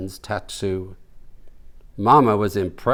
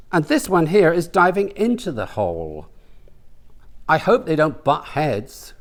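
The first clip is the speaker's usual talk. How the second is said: put-on high, delighted old lady; older woman's voice; 7 semitones higher than usual. Mama admires the gerbil tattoo, high delighted voice. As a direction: gush over it in a high voice.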